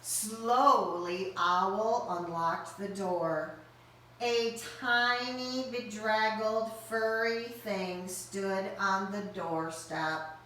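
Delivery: ordinary delivery, nothing marked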